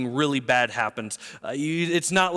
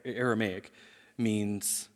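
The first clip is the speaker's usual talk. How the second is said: ordinary talk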